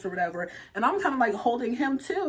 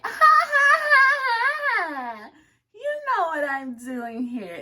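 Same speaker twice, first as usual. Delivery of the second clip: high, loud put-on giggle, then drawn-out teasing; coy mock laugh sliding into a flirty line; playing cute; 12 semitones higher, 6 dB louder, much slower than usual. Flirting with the guy at his door, playing cute. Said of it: "being all cute".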